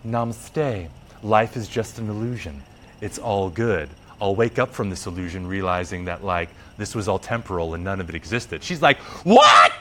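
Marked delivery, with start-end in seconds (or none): 0.1-8.6 low, soft, serene mock guru; hushed, low spoof of spiritual detachment; super spiritual. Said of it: "the super spiritual thing". 9.2-9.7 loud, high outraged yell; hammed-up furious shriek of disbelief; going ballistic. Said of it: "fucking ballistic on Shiva".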